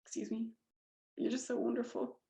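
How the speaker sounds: holding back tears, voice dropping; choked up. Real, not acted. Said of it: "I'm gonna get emotional".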